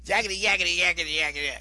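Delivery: comic yakety-yak crowd noise; imitated crowd chatter. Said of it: "The audience is back talking just as loud".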